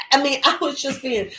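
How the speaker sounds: usual speaking voice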